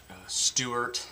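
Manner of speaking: ordinary talk, nothing marked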